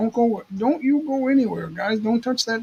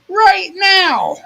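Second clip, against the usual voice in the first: loud insistent call; yelling across the room; 10 semitones higher, 6 dB louder than usual. Real, not acted. Calling people, insisting.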